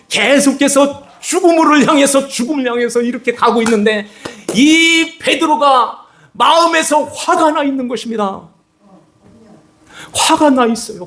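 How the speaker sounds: high, urgent preaching voice; fervent preaching. Acted. Preacher telling the crowd of Peter's rage, fervent. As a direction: pour it out at full force.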